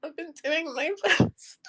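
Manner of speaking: real tearful despair; crying. Real, not acted. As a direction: force the words out through tears. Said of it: "I'm just crying".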